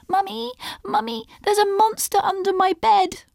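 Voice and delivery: put-on very high little-child voice calling out; scared child. Child calling mummy about a monster under the bed, very high. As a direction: call mummy back like a frightened kid.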